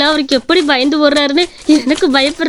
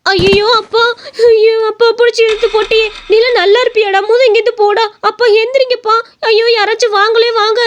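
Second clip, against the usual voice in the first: screaming for help; 8 semitones higher than usual. Acted. Daughter screaming for help after her father is knocked down, wailing. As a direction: scream out in panic for your father. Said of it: "அவ கத்துற சத்தத்தை கேட்டு".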